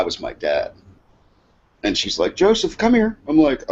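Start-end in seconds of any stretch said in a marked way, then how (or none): 2.3-3.2 high-pitched summons to a son; imitating grandma calling out; imitated grandmother. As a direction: call him over in a high mock-grandma voice. Confused grandmother summons supposed son.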